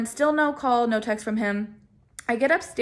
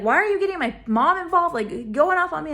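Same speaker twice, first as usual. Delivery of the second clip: imitated irritated outburst, higher pitched; mildly acted snapping complaint; snapping boyfriend; 5 semitones higher than usual. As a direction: retell his snap with a touch of annoyance. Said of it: "he snaps at me like".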